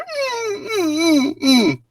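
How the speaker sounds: high muffled mm sounds through a gag; muffled terrified whimpers; gagged whimpers. Acted. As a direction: whimper with mouth shut.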